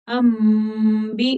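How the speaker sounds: अम्बिऊनी slowly, n turned to m; अम्बिऊनी slow, lips closed on the m; model pronunciation. Shown as a demonstration. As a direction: say अम्बिऊनी with an m, not अन. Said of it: "अन नहीं पढ़ेंगे".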